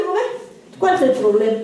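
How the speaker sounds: ordinary talk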